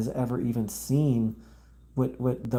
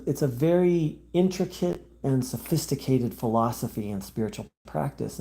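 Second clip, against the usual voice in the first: ordinary talk, nothing marked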